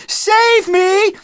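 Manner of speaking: very high, loud shriek; girl shrieking in panic on stage, loud shriek. Acted. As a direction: shriek for rescue. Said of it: "shrieked Agnes".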